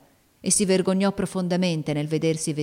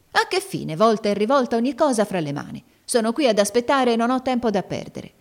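raised, impatient nagging; impatient scolding; 6 semitones higher, 3 dB louder than usual. A narrator's reading. Maid scolding the girl for dawdling, raised and impatient. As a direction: hurry her along, fed up. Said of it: "dare segni manifesti di impazienza".

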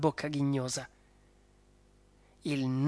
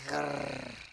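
dog growl; 7 dB quieter than usual. Acted. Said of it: "ringhiò".

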